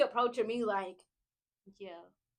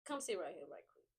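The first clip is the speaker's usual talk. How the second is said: very soft, low coaxing; gentle correction; 6 semitones lower, 15 dB quieter than usual. Acted. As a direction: quietly call someone over to sit. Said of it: "It's always very gentle, though".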